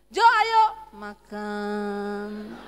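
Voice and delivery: loud call sinking into long low last word; tone dropping at end. Retold or imitated. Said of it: "nek ngomong nadanya turun". (nada turun mendadak di akhir)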